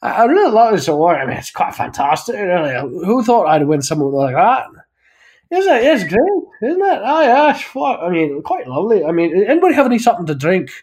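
high, excited mock Irish brogue; Irish acceptance speech. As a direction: gush and beg for a drink in an Irish accent. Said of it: "the Irish people will get up there and just be like".

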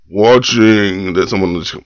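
normal talking voice

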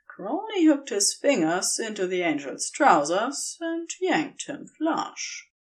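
usual speaking voice

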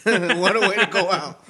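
laughing through the words; loud laughing line. Real, not acted. Laughing at Kinder toy.

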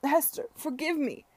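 high, loud plea; plaintive cry. A narrator's reading. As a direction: cry out begging for forgiveness. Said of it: "he cried plaintively".

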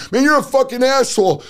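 high, loud insult thrown back; angry customer. Retold or imitated. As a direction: spit it at the salesman. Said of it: "customer rejects the calm reply".

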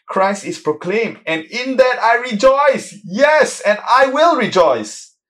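preacher crying out in triumph; preaching at full force. Acted. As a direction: lift the room with joy in rejoicing. Preaching climax on rejoicing.

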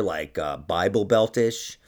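normal talking voice

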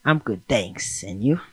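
low, slow, soft seductive reply; sexy. Acted. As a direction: drop it low and quiet, seductively. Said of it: "Why you sexy too".

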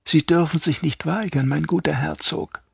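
lightly voiced traitor doctor; Yui, lightly voiced. A narrator's reading. Yui persuades the duke.

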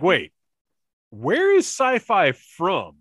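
rising, drawn-out baffled questions; slow, puzzled wondering about an accent; surprised disbelief. Retold or imitated. A fan wondering aloud where a character's drawl comes from, baffled. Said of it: "I didn't expect that twang, that drawl".

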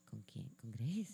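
soft, low, stunned whisper; hushed disbelief. Retold or imitated. Retold surprised question.

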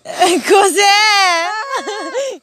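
sudden high startled shout; startled cry. Real, not acted. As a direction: jump and cry out in alarm.

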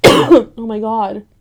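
coughing it out. Real, not acted. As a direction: choke out through a cough. Coughing fit after spraying.

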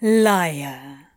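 low, fond murmur; fondly. A narrator's reading. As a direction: say it with lazy affection. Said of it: "Crowley said affectionately".

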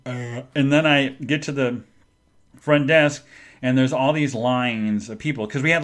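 ordinary delivery

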